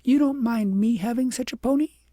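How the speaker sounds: high, sweet, repeating the offer; a child offering kindly with a smile; little girl asking princess again, smiling. A narrator's reading.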